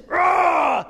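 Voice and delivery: loud, high-pitched full roar; sudden mighty roar; big lion roar. Retold or imitated. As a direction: imitate a huge roar bursting out. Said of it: "out comes this".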